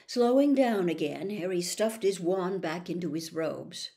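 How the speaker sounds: ordinary delivery, nothing marked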